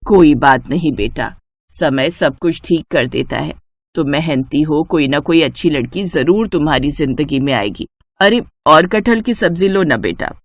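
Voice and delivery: mother-in-law comforting the lonely neighbour; consoling mother-in-law. Acted. Shobha consoles Rohit.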